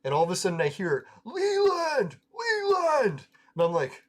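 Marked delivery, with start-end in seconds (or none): 1.2-3.3 high-pitched, drawn-out name call; imitated yell. Drunk friend calls out to him in the truck, imitated high-pitched yell. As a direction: mimic a wasted friend yelling across the street. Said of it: "Who's yelling my name?".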